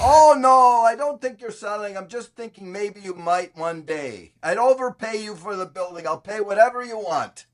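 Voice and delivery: high, goofy, over-eager reassurance; idiotic caller. Retold or imitated. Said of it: "if I act totally idiotic and stupid".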